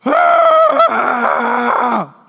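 put-on high piercing scream like a woken child; toddler's startled scream. Pastor imitating niece Ella woken from nap, loud scream. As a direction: shriek like a three-year-old yanked from a nap. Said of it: "her default response ... is to go".

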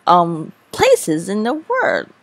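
exaggerated YouTube countdown voice; clickbait video narrator. Shown as a demonstration. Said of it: "you need to exaggerate things".

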